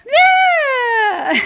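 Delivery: very high, loud shriek; leprechaun shriek. Acted. Leprechaun screeches at the group on his board. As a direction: scream it as he rides by. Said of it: "he goes by again".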